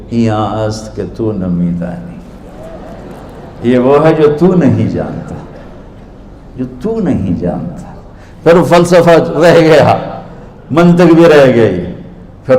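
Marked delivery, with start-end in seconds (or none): 0.1-7.9 innocent, soft reply. Acted. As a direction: answer gently like a simple villager. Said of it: "بڑی معصومیت سے بولے".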